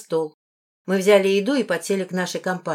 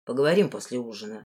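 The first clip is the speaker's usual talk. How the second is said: low, softer promise; composed lord; 8 semitones lower, 5 dB quieter, faster than usual. A narrator's reading. The lord puts off the talk until later, low and calm. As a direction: lower the voice, quietly in charge.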